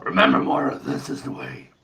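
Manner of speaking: deep, soft helmeted Mandalorian line. Pretend. Friend in a Mandalorian helmet telling Moira, low and hushed. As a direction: intone it low like a helmeted warrior.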